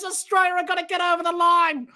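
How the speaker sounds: usual speaking voice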